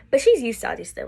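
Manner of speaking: ordinary talk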